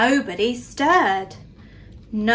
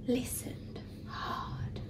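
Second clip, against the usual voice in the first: whisper; 16 dB quieter, slower than usual. A narrator's reading. Narrator hushes the listening moment.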